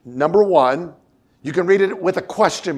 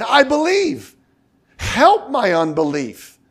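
high, raised cry of I believe; 7 semitones higher than usual. Acted. The father crying out to Jesus, high and pleading. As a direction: call it out with passion. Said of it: "cried out".

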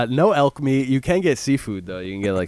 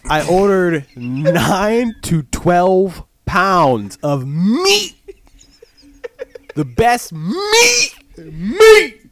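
dramatic reveal; 6 semitones higher, 4 dB louder, much slower than usual. Acted. Dramatic slow meat reveal.

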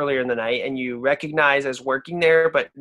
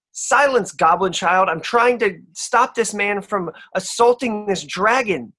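haughty barmaid; 6 semitones higher, slower than usual. Acted. Barmaid to the goblin, high and scornful. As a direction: shut the goblin up.

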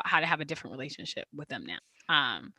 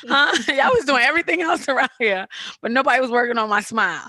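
louder, higher laughing protest; mock complaint; 5 semitones higher, 4 dB louder than usual. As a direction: complain to mom through laughs. Mock scolding her mom, laughing.

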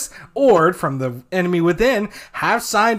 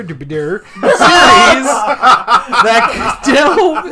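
laughing through words; 4 semitones higher, 5 dB louder, slower than usual. Real, not acted. Stumbling, laughing while reading.

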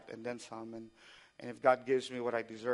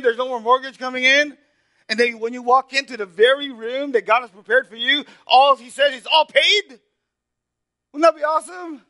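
full-force preaching; 12 semitones higher, 15 dB louder than usual. Acted. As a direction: pour out Paul's words to the congregation at full force.

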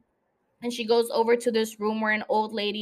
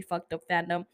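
ordinary talk

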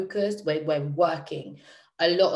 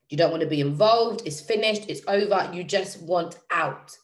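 ordinary talk, nothing marked

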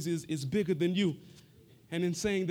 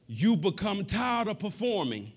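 ordinary talk

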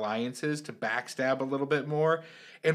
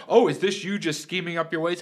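mock dumb question; 5 semitones higher, 4 dB louder than usual. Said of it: "They're like".

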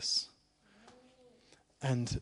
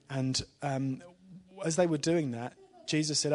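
usual speaking voice

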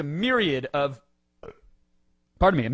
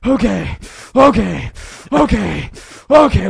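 high, loud, gulping sob on each okay; imitating child crying; 8 semitones higher, 14 dB louder, slower than usual. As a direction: act out choking back a child's sob. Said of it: "that child cry where you".

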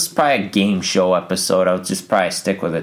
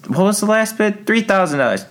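higher, announcer-like host voice; host parody; 11 semitones higher than usual. Retold or imitated. Game show host asking for bids, higher parody. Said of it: "Family Guy made fun of that".

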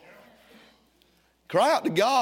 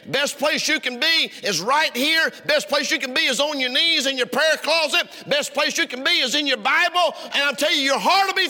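high, repeated, hammered sermon line; rising repetition from the pulpit; building preacher refrain; 7 semitones higher, 3 dB louder than usual. Acted. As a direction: drive each repeat home to the crowd.

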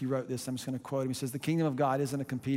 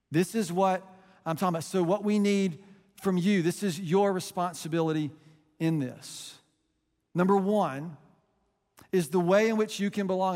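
rising, emphatic sermon delivery; preaching; 4 semitones higher, 5 dB louder than usual. Acted. Pastor urging college students to show up, in full preaching voice. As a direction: preach it, pushing each phrase upward.

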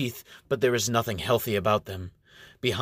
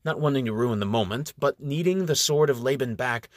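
ordinary delivery, nothing marked

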